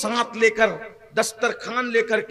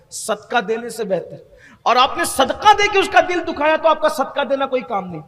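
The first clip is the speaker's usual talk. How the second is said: usual speaking voice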